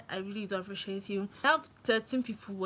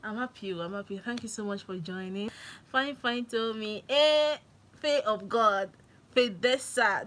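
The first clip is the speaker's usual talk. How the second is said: ordinary talk, nothing marked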